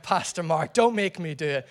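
ordinary talk

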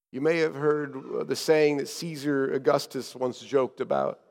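normal talking voice

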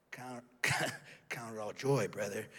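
slow, low, held-back anger; restrained anger. Acted. Angry dishwasher answering the arrogant brother, tightly restrained. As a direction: force it out softly through gritted teeth.

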